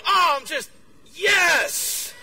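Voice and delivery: loud, high acted cheer; too thrilled to explain, shouting; exultant shout. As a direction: burst into a shout mid-explanation. Explaining elation to puzzled coworkers.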